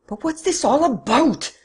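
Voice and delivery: high, slow, loud bellow. Acted. Don Luis bellows at his captors, loud and slow. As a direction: bellow out the protest. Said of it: "bellowed Don Luis".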